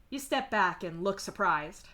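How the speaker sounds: ordinary delivery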